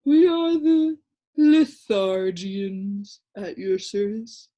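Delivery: slow, drowsy creature drawl; a dull little creature explaining to Milo, slow and sleepy; sleepy Lithargian. A narrator's reading. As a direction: drone it lazily like a half-asleep creature. Creature speaks.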